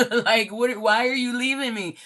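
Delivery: loud, high-pitched mock pleading; mock lament. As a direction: act out an abandoned lover, hammed up. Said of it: "they're crying over you".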